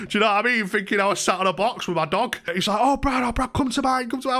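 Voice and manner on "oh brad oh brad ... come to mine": high-pitched frantic pleading; mock panicked friend. Friend Connor phoning after hearing of the eviction, imitated panic. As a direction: imitate a worried mate urging him over. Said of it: "he ran me up panicking".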